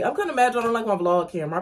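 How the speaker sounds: ordinary talk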